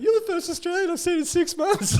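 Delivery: very high mock tearful squeal; crying happy. Skydive instructor meeting his first Australian in months, crying happy. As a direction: imitate someone weeping with joy. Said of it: "crying happy".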